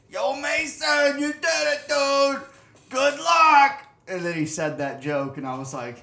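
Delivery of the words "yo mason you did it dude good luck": loud, high shouted greeting from a rowdy coworker; rowdy shipyard pal hollering congrats; Boston coworker. Retold or imitated. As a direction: yell it across the break room like a buddy. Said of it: "this guy was like".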